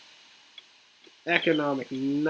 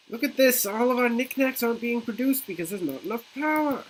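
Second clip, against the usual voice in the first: alarmed exclaiming; 8 semitones higher than usual. Real, not acted. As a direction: sound the alarm. Alarm at failing city power.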